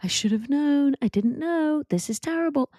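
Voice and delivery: spiral into alarm; panicked self-quote. Retold or imitated. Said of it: "I was like".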